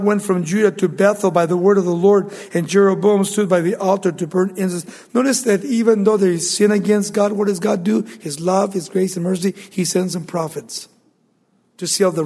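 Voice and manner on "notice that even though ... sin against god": usual speaking voice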